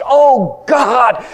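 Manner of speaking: loud, high and slow pleading; anguished prayer. Retold or imitated. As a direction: plead slowly for a dying daughter. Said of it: "Crying and sobbing".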